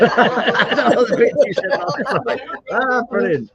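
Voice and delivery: cracking up while talking; high, delighted laughter in the voice; laughing. Real, not acted. Cracking up at friend's joke.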